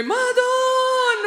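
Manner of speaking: high, long, wailed invocation; calling out. Retold or imitated. Mother calling out to the Virgin Mary, imitated wail. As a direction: wail it to the heavens on one held note. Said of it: "calling out to the Virgin Mary".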